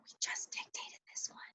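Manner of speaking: an unvoiced whispered aside to herself. Real, not acted. Teacher whispering a side note mid-lesson, unvoiced. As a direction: drop to a whisper as a quick remark.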